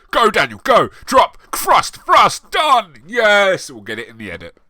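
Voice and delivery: loud, high barked set directions; mock director shouting. Director shouting at Daniel Radcliffe on set, hammed-up yelling. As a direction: yell orders at the actor like a frantic director. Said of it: "if this was a script".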